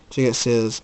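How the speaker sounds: ordinary delivery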